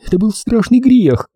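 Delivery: high-pitched fearful woman; anxious female confession, no real whisper; frightened. Acted. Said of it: "тем же испуганным шёпотом".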